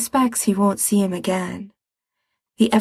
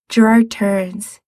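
ordinary delivery

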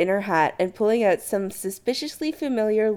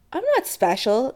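higher-pitched quick protest; blurting; 7 semitones higher than usual. Acted. Chris to Adam, blurting. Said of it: "he blurts".

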